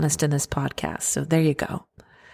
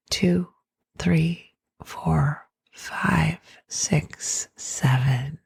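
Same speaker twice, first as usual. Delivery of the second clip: guided meditation; slower than usual. Acted. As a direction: count the breaths gently. Leads a breathing meditation.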